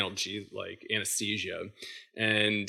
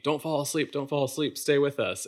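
raised, pleading call to stay awake; urgent doctor's call; 5 semitones higher than usual. Retold or imitated. Doctor calling to the patient in the scanner, urgent raised plea. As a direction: keep him from slipping away.

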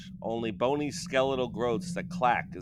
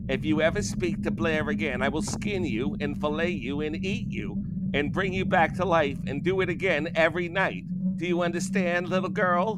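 menacing witch threat; 7 semitones higher than usual. A narrator's reading.

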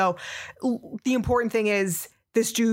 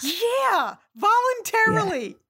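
incredulous; 12 semitones higher, slower than usual. Real, not acted. Outraged at co-host's question.

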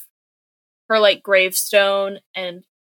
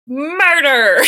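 raised, drawn-out mock shout; mock dramatic accusation; 5 semitones higher, 4 dB louder than usual. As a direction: ham up the grave charge, then crack up. Police announce the charge, hammed up.

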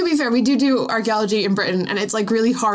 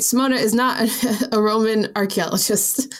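usual speaking voice

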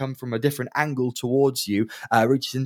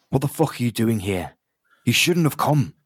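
exasperated, talking down to fools; exasperated barman. Acted. Barman confronts party.

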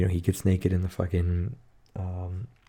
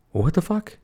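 shocked; 10 semitones higher than usual. Real, not acted. Shocked reaction to movie death.

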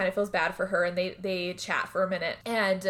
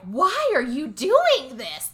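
slow, louder, drawn-out plea; mock hurt; 5 semitones higher, 8 dB louder, much slower than usual. [name] on the phone to Mary, slow and wounded. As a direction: stretch each word, wounded.

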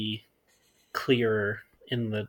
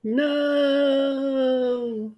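mock dramatic gasp; 13 semitones higher than usual. Mock dismay at petrified news.

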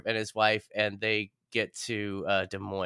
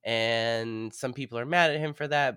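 normal talking voice

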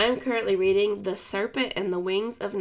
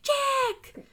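much higher, whining call of the name; whiny yell. Retold or imitated. Imitating the narrator calling Jack with a whine. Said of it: "like, with a little whine".

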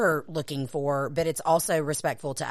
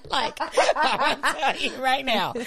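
laughing; 7 semitones higher than usual. Real, not acted. Laughing while talking.

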